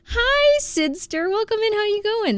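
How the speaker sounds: high-pitched happy welcome; high, bubbly hello; excited greeting. Real, not acted. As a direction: greet an arriving friend in a high voice. Excited greeting of an arriving viewer.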